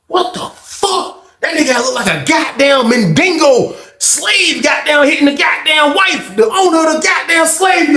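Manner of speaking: high-pitched, strained comic disbelief; incredulous outburst. Retold or imitated. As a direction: rant it way up high, astonished.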